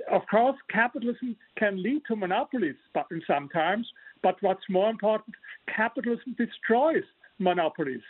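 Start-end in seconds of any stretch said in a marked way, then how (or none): none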